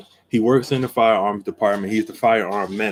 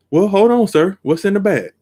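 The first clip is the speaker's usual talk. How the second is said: higher and louder, a firm stop; firm challenge; 8 semitones higher, 4 dB louder than usual. Retold or imitated. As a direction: stand your ground and demand to see the bag. Stopping the thief at the buggy.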